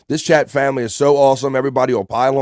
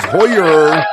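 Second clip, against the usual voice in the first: put-on raised, louder accent with an R ending on Hoyer; Bob Levy; 4 semitones higher, 5 dB louder than usual. Reading a chat greeting aloud in Bob Levy's accent. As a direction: call it out loudly in an accent that turns the final A into R. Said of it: "saying that with the Bob Levy accent".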